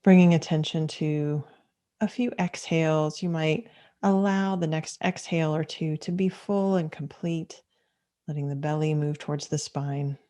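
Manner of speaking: unhurried meditation guidance with long pauses; calm, measured mindfulness teacher leading a practice; guided meditation. Acted. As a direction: guide listeners gently into stillness, leaving space between phrases.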